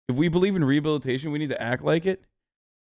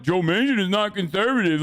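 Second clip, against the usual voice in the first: mocking imitation; 4 semitones higher than usual. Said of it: "sit here and be like".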